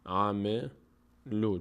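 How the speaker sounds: very slow, stretched "عملو"; syllable-by-syllable recitation. Shown as a demonstration. Teacher repeating the word for students, very slow recitation. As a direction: draw "عملو" out one syllable at a time. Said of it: "اگر ما بعد این چیزی نمی بود".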